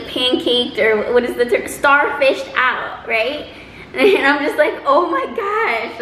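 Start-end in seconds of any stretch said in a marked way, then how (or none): 4.9-6.0 surprised. Retold or imitated. Shocked at the passed-out man.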